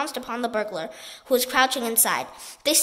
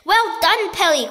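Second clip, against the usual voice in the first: higher, louder cheer; cheering cry; 5 semitones higher, 6 dB louder, slower than usual. A narrator's reading. Giraffe praising the pelican, cheering. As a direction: praise him joyfully. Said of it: "cried the giraffe".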